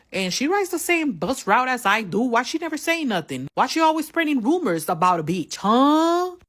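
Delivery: high, loud indignant rant; heated rant. Real, not acted. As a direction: vent about her, rising to the huh. Ranting about the rival.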